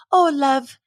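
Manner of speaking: an Irish grandmother calling you love; Irish accent. Retold or imitated. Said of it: "in her Irish accent".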